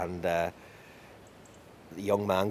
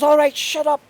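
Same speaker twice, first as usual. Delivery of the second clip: very high, loud yell over the wailing; exasperated shouting; 11 semitones higher, 9 dB louder than usual. A narrator's reading. Fairy king gives in to the bawling man, shouting. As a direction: give in at the top of your voice. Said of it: "he just bawls again".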